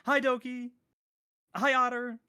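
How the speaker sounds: high, loud cartoon hello; high-pitched roll-call greeting; SpongeBob. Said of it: "I'm gonna pull a SpongeBob".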